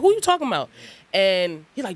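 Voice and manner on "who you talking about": high-pitched disbelieving question; high incredulous. Retold or imitated. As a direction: squeak it out in disbelief. Friends retort again.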